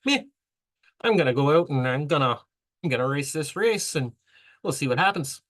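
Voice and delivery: casual Aussie sheep farmer, bad Australian accent; Cliff Young Australian accent. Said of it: "I know my Australian accent sucks".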